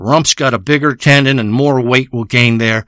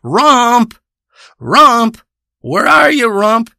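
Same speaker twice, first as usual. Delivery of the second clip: calling out; 8 semitones higher, much slower than usual. Pretend. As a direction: shout for the cat across the shore. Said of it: "So I call out".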